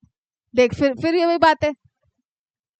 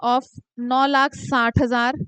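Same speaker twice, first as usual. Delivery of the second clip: slow working aloud; 3 semitones lower, much slower than usual. Shown as a demonstration. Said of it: "तो अब आप क्या करोगे".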